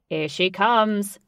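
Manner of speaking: loud, slow, proud call; slow proud shout. A narrator's reading. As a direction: announce the big arrival. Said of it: "shouted Ajax proudly".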